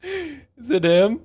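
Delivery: slow, high, disbelieving; incredulous. Real, not acted. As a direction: drag it out in disbelief. Incredulous repeat after laughing.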